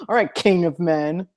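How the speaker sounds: dry mock-deferential put-down; sarcastic. Shown as a demonstration. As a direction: say it sarcastically, as a dismissive jab. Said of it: "I would say it sarcastically".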